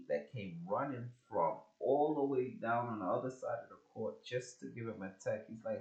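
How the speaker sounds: usual speaking voice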